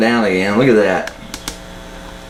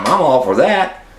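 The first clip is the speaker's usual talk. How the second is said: ordinary delivery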